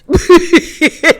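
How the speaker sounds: big high burst of laughter; loud laugh. Real, not acted. Laugh alone on line.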